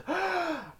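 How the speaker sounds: high, exaggerated gasp; shocked gasp. Acting out Chocolate Surprise's shock, high gasp. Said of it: "that he's gasping".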